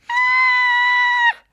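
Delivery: long, very high, loud scream; play scream. Pretend. [name] spots a pretend crocodile, high play scream. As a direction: shriek in pretend fright. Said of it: "don't forget to scream".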